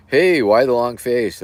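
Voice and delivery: a hint of a loud bartender; lightly voiced bartender. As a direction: call out the joke line like a barkeep. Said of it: "the bartender goes".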